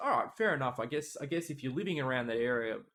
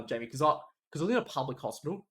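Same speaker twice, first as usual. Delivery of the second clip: normal talking voice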